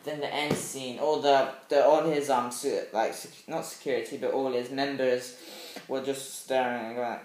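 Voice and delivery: ordinary delivery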